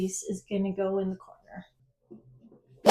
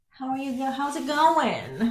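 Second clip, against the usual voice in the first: playful greeting; 5 semitones higher, 3 dB louder than usual. Pretend. Mock greeting the wallpaper piece, sing-song. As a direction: greet it like a new friend.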